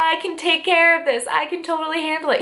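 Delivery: high, breezy, falsely sure; overconfident witch wife. Retold or imitated. Wife in the candle circle reassuring her husband, high and breezy. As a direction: brush it off like it's nothing. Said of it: "She's like".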